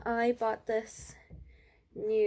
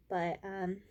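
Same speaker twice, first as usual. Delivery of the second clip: usual speaking voice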